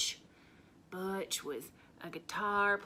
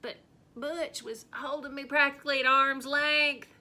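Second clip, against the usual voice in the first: exasperated; 5 semitones higher, 9 dB louder than usual. Acted. Comic frustration.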